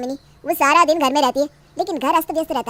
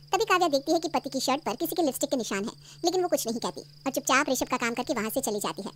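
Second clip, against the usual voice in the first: story narration; 8 dB quieter than usual. A narrator's reading. Narrator revealing the lipstick mark to listeners, hushed narration. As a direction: narrate quietly what she noticed.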